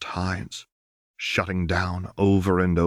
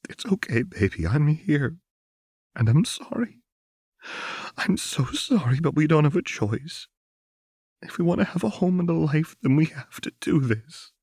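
higher, tearful comforting voice; Lux crying; 9 semitones higher than usual. A narrator's reading. Lux comforting her crying wife, tearful and higher. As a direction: soothe her through your own tears. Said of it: "Lux was crying now".

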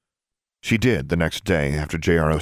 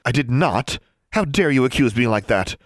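higher-pitched, offended denial; indignant; 7 semitones higher than usual. A narrator's reading. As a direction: protest the accusation hotly. Heated quarrel, indignant denial.

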